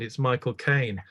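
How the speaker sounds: ordinary delivery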